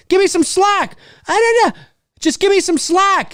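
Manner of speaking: high-pitched, urgent movie-hero plea; Harrison Ford impression. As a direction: imitate an action hero's high-voiced plea. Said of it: "He says it like that".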